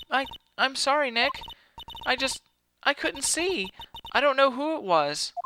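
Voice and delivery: put-on very high, halting girl voice; apologetic, upset Maya. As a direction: falter apologetically, pitched right up.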